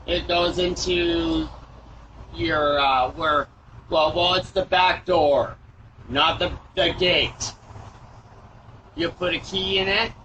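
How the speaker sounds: slow halting doorbell camera audio; drawn-out, hesitant words over a doorbell mic; doorbell speaker. Real, not acted. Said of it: "his words get even stranger".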